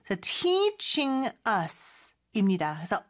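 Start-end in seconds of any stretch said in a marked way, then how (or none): 0.2-1.3 "teaching" slow, with a long drawn-out tee; "teaching" as teee-ching, pitched high; lengthened vowel. Shown as a demonstration. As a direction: stretch the first syllable of "teaching". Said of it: "T 길게 끌어야 되겠죠?".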